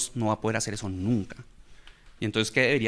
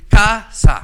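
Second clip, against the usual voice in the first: CASA in two loud, high, separate beats; accenting with claps; 9 semitones higher, 13 dB louder than usual. Shown as a demonstration. Teacher shows students the clapped way to accent, loud split syllables. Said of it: "me enseñaron a acentuar con las palmas".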